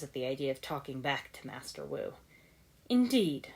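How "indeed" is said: dry. A narrator's reading. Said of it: "Sensei Wu said dryly".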